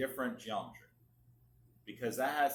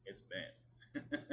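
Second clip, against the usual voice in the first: a light, joking Southern drawl on "bent"; funny southern accent; 4 semitones lower, 11 dB quieter than usual. Shown as a demonstration. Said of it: "a funny southern accent or something".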